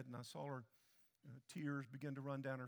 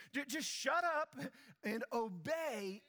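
much higher, louder, shouted command; 8 semitones higher, 6 dB louder, slower than usual. Real, not acted. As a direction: belt it out from the pulpit. Preacher repeats the command, shouting.

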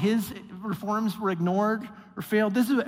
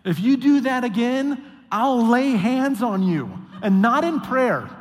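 louder, slow, threatening read; stern warning; 4 semitones higher, 6 dB louder than usual. Retold or imitated. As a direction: lean into the threat. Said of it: "this is what he says".

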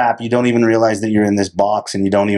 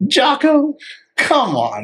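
high squeak dropping to normal pitch; mock disbelieving protest; slower than usual. Amused viewer appealing to Jocko over jujitsu, mock exasperation.

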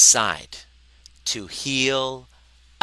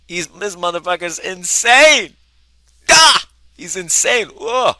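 high, loud, wide-eyed yelling about a madman; shocked shout; 8 semitones higher, 11 dB louder than usual. Retold or imitated. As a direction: holler it like you can't believe what you're seeing. Acting out the shocked viewer.

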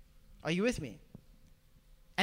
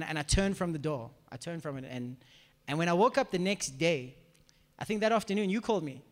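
usual speaking voice

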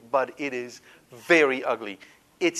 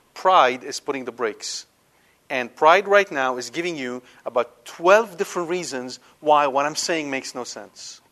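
ordinary talk

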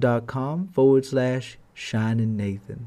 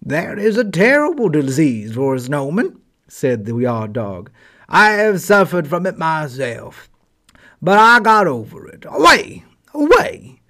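barking dog; 3 semitones higher, 6 dB louder than usual. Acted. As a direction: let the dog's voice climb into a bark. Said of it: "he barked".

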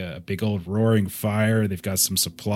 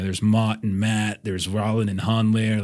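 ordinary delivery

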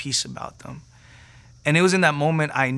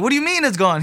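higher-pitched disbelieving question; incredulous; 8 semitones higher, faster than usual. Retold or imitated. Praying man hearing the pain is gone, incredulous.

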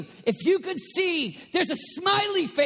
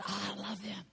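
tender aside; 7 semitones lower, 12 dB quieter than usual. Acted. God voiced tenderly.

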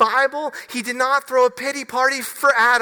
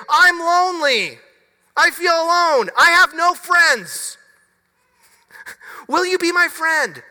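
high, loud whiny confession of loneliness; hammed-up lonely whine; mock whiny lonely plea; 8 semitones higher, 6 dB louder than usual. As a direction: play it as a pitiful, whiny cry for a friend.

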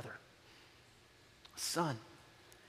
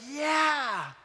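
mock cheer; 8 semitones higher than usual. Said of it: "the brother would be like".

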